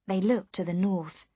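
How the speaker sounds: plain reading voice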